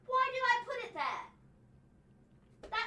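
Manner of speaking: high, very drawn-out retort; mock indignation. Host answering the blame for a misplaced figure, mock indignant. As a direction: throw the blame back, high and slow.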